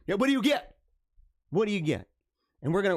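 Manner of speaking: ordinary talk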